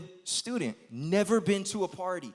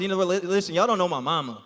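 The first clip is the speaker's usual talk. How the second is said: ordinary delivery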